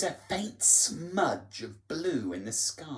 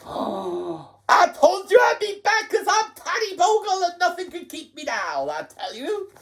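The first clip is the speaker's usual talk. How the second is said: triumphant Tatty Bogle; 6 dB louder than usual. Acted. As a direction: crow about coming back.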